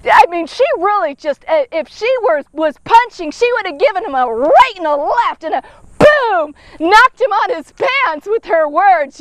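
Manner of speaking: ordinary delivery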